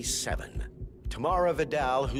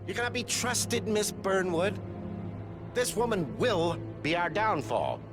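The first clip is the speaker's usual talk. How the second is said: denunciation; 6 semitones higher than usual. Acted. Denouncing a rival before the room.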